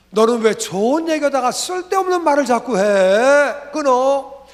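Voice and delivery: loud, high, exasperated retort; acted annoyance. Older brother refusing church talk on the phone, acted annoyance. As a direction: imitate a brother snapping and hanging up.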